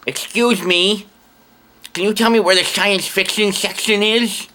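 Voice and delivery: high sci-fi nerd voice; geeky voice. Retold or imitated. Customer asking where the science fiction section is, in geeky nerd voice. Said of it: "That guy had the voice".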